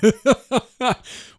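a high, loud burst of laughter. Real, not acted. Partner laughs at the reaction.

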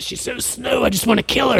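high-pitched exasperated husband act; mock exasperation. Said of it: "you're just so mad at".

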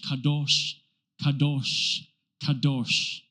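"Kadosh" said three times, slow and soft with stress on "dosh"; Hebrew kadosh. Shown as a demonstration. Preacher teaching the Hebrew word "kadosh" to the church, soft slow chant. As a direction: chant "kadosh" gently, stressing the last syllable. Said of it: "Everyone say kadosh".

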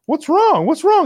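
high, worried asking; concerned high voice. Retold or imitated. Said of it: "Jerry's like".

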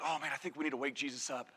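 low, quick, hushed aside; timid suggestion. Acted. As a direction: suggest it quietly, in a low hurried voice. Worried disciple hesitantly suggests waking Jesus.

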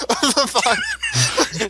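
laughing through the words. Real, not acted. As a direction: giggle through the sentence.

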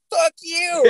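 high, gleeful taunt after a winning ride; cocky taunt. Retold or imitated. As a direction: jeer it back with triumph.

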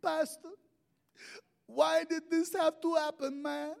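put-on high, slow, pleading Puerto Rican accent. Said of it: "His Puerto Rican".

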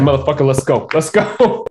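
rising, hyped "let's go" taunt; hammed-up street-fight challenge; Alfred ready to brawl. Alfred taunting Bruce into a fight, mock hyped. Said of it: "He's like".

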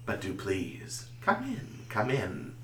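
soft, hushed villain invitation; creepy whispery spider. Acted. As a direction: draw them in softly like a spider. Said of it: "he puts his feet up and he says".

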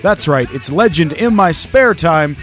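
ringmaster. Acted. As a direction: roll up, roll up, big top style. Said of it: "showman welcome to the podcast".

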